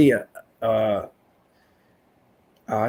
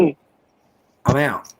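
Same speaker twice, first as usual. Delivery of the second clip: disgusted; 3 semitones higher, 7 dB louder than usual. Real, not acted. Disgusted reaction.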